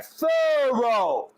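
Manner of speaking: THOROUGH stretched high, THUR-OH with a U; U sound. Shown as a demonstration. Co-host coaching his partner to put a U in, high stretched THOROUGH. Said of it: "put a U in there".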